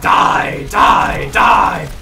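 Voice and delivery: punchy bellowed kill call; loud, barked game taunt; shouted die. Pretend. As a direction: shout each word hard and separately.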